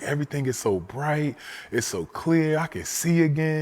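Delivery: high, marvelling grandmother voice; overjoyed grandma. Retold or imitated. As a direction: testify it like a small miracle. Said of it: "she's telling my mother".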